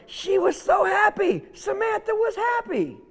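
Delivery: very high, delighted lift; joyful storytelling. A narrator's reading. Storyteller telling how the animals came back to Samantha, high and joyful. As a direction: let the voice soar with happiness.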